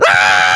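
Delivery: loud low yelp of pain; a sudden stung shout. Acted. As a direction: pizza man yelping as scorpions sting his toes. Scorpions sting his toes.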